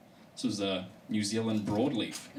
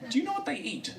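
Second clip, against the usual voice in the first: teasing question; faster than usual. Acted.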